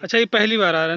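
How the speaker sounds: blurting it out, thrilled at the view; excited surprise. Real, not acted. Vlogger spotting the mountain for the first time, excited.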